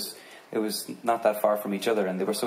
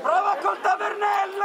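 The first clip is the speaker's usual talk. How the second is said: loud, much higher cheer; cheering the friend for downing the wine; excited shout; 5 dB louder than usual. Real, not acted. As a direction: yell it out in praise. Cheering the friend's drinking on stage.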